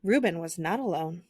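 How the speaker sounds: ordinary delivery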